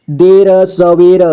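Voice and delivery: देर सवेर slowly, each र sounded apart; syllable by syllable. Shown as a demonstration. Teacher breaking down देर सवेर for learners, slowly syllable by syllable. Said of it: "जोड़ जोड़ करके".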